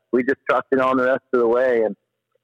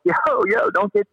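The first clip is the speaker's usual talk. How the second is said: high-pitched calling out to a paddling partner; retold urgent warning; 11 semitones higher than usual. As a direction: re-enact warning a friend away, voice raised high.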